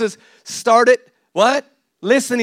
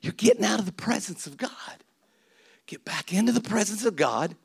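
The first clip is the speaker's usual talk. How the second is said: soft, half-whispered pastoral plea; hushed appeal; 8 dB quieter than usual. Real, not acted. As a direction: drop the volume and press gently. Hushed appeal after loud preaching.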